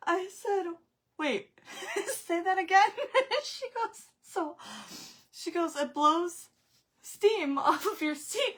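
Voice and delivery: very high, loud, words swallowed by laughter; breathless laughing. Real, not acted. Mother telling about the steam seat in her daughter's sauna, breathless laughing. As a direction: laugh until you can hardly breathe. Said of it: "I can't breathe at this".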